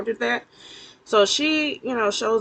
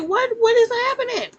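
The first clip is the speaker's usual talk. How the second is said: much higher, louder: what is happening; shocked disbelief; 13 semitones higher, 3 dB louder than usual. Real, not acted. Reacting to Criston beating the man, high shocked cry. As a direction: blurt it out in total shock.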